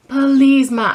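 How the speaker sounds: high, loud, stretched-out please; exasperated begging. Retold or imitated. Daughter begs mom to stop setting her up, exasperated begging. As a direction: beg mom with mock desperation.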